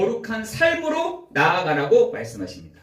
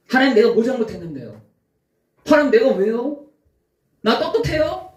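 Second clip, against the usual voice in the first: self-righteous protest; 5 semitones higher than usual. Retold or imitated. Mocking a self-righteous believer.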